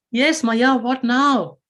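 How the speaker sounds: put-on a kid fed up with being called back; impatient boy. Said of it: "Krishna goes".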